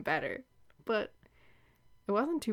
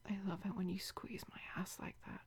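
soft, unvoiced seductive whisper; intimate whisper; 13 dB quieter, faster than usual. Whispering to her lover as he touches her, seductive. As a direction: breathe it into his ear.

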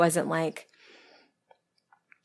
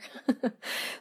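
breathy giggle; 7 semitones higher, 7 dB quieter than usual. Real, not acted. Laughs about making it filthier.

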